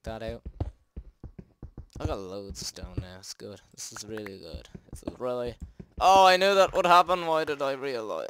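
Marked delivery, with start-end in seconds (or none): 6.0-8.3 high, loud panicked cry; panicked yell. Real, not acted. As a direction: cry out as it goes wrong. Creature attacks, panics.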